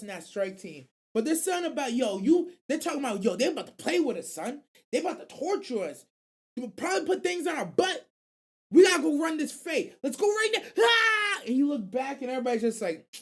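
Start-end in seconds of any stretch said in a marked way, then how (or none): 3.2-10.6 high, loud rising panic; panicked yell. Retold or imitated. Panicked captain rallying. 10.8-11.4 loud, high yell of panic; high panicked scream. Retold or imitated. Battle cry.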